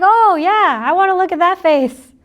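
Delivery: light up with pleased interest; delighted eagerness. Acted. Speaker reacting to a laughing listener, delighted and eager. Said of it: "You laughing is like".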